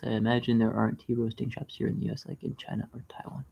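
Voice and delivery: softly whispered aside. Real, not acted. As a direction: drop to a whisper.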